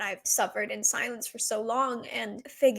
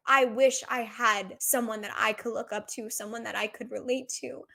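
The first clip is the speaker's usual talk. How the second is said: ordinary talk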